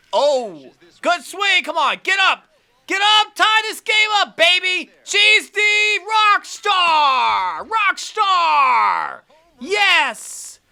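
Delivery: shouting high and loud; excited cheering. Real, not acted. Gamer yells at the screen for her batter, high and loud. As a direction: scream her on like a fan in the stands.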